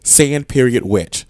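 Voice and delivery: "Sand period witch" slow, each piece set apart; exaggerated separation. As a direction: spell the pause out between "Sand" and "witch". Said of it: "your words are so separated".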